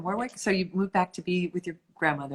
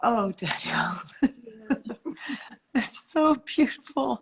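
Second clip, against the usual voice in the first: whispered, tearful laugh rising into higher praise; deeply touched, whisper then slow down; moved near tears; 5 semitones higher, slower than usual. Real, not acted. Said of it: "I'm gonna cry again".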